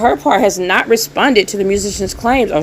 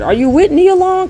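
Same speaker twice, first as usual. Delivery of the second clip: incredulous; 12 semitones higher, 3 dB louder than usual. Real, not acted. Incredulous question about the interview.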